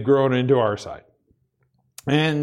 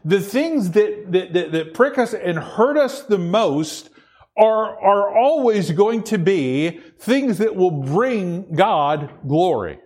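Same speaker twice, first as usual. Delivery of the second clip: drawn out, slow preaching emphasis; slow right down to drive the point home; 3 semitones higher, slower than usual. Real, not acted. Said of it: "preacher slows to drive home".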